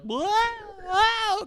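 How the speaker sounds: very high drawn-out "whoa" cry; mock wailing whoa. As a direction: imitate someone yelling while swung around. Imitates Seth Rollins while being swung.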